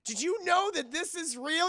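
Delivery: high, loud eager voice; eager question. Retold or imitated. Johnny Cage asking Liu Kang, high eager voice. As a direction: bounce up to him thrilled. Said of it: "He's like".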